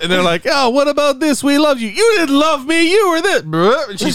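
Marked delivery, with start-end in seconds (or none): 0.4-3.4 very high, put-on bickering voices; mock family squabble. Imitating the family arguing at dinner, high mock squabble. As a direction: squeak out a hammed-up argument. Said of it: "they're going back and forth arguing".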